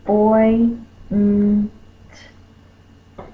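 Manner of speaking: "oy, n, t" each sound stretched and separate. Shown as a demonstration. Teacher segmenting appoint for children, sound by sound.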